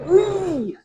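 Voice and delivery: high-pitched playful squeal; a gleeful little cry; playful whee. Real, not acted. Pulling down the loft ladder.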